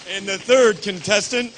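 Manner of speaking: game show host calling out; announcer voice. Acted. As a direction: stretch the names like an emcee. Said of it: "This is my announcer voice".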